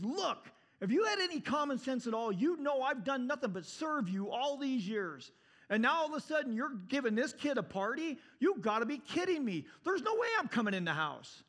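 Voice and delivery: high, heated protest of a wronged son; aggrieved, incredulous outburst; angry complaining. Acted. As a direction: act out an indignant tirade, pitch up throughout. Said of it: "they're complaining".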